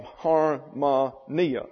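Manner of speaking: "harmonia" slowly broken up, har-ma-NEE-a; stress on penultimate syllable. Shown as a demonstration. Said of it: "accent on the next to the last syllable".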